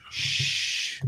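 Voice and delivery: soft unvoiced shush. Host hushing room for pictures, playful shush. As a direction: hush everyone playfully.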